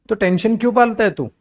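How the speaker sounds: usual speaking voice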